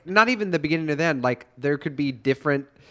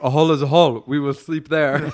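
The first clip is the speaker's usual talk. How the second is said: lightly voiced dumb brute; caveman voice; 7 dB louder than usual. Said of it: "your caveman voice".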